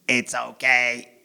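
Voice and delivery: slow, measured robot monotone; dad's slow soothing robot act. Acted. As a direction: talk like a reassuring robot, word by word. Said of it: "I talk and act like a robot".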